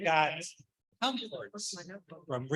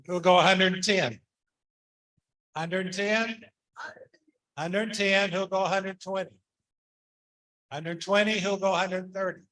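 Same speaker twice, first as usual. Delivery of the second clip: brisk auction bid calling; auctioneer; 2 semitones higher, 6 dB louder than usual. Acted. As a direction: call the bids like an auctioneer. Calls bids at auction.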